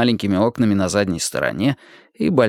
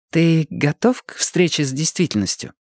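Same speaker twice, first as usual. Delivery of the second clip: higher-pitched question to the learner; вопрос Марты; 5 semitones higher than usual. Acted. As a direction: put the question in her higher voice. Martha's question, echoed in answer. (вопрос повыше, женским голосом)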